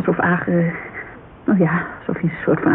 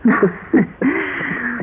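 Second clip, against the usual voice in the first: laugh breaking into a sob; 4 semitones higher than usual. Acted. Laugh turning into crying.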